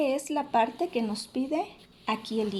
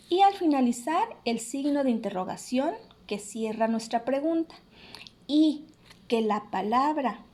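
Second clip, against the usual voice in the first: ordinary talk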